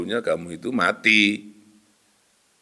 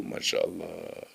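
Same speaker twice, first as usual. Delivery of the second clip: whispered dismay; 5 semitones lower, 16 dB quieter than usual. Real, not acted. Dismayed aside after gambling story.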